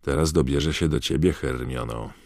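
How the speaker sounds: ordinary talk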